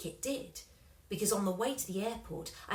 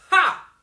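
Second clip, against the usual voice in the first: sharp loud scoffing ha, much higher; scornful ha; 10 semitones higher, 16 dB louder than usual. A narrator's reading. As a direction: bark a triumphant laugh. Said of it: "said Mister Slingsby".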